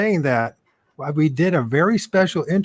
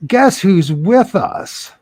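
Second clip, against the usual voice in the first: slow, loud, high showman announcement; announcing a guest; 6 semitones higher, 4 dB louder, slower than usual. Real, not acted. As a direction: draw it out like a host unveiling a star. Announcing surprise guest arrival.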